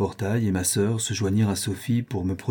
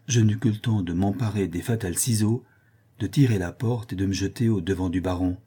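ordinary delivery, nothing marked

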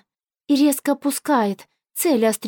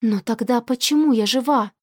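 ordinary delivery, nothing marked

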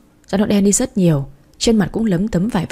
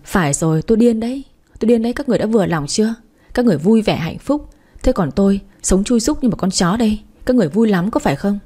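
ordinary delivery, nothing marked

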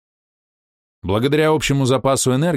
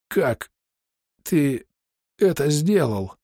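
strained, pained high voice; forcing words through pain; 6 semitones higher, much slower than usual. A narrator's reading. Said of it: "через боль спросил Балвей".

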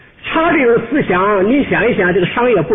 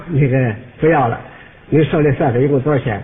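low-pitched, unassuming customer; polite customer; 6 semitones lower than usual. Acted. Customer asking the clerk for the total, low and polite. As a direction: ask in a lower, mild voice.

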